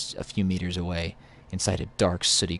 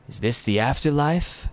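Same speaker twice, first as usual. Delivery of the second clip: pitched-up stranger's voice; Drifter impression; 7 semitones higher than usual. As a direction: play the rough-living wanderer, higher. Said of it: "I'm gonna do my Drifter impression".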